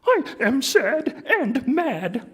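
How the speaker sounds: high, slow sulky puppet voice; Grover voice. Acted. Grover telling Oscar and Big Bird, slow high sulk. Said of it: "letting me do the voices".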